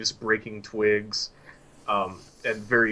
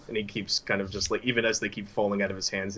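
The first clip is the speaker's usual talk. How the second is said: ordinary talk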